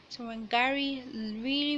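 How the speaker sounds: ordinary delivery, nothing marked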